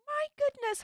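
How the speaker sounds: shocked by a big gift; amazed gasp. Real, not acted. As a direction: react as if bowled over. Reacting to a fifty dollar gift.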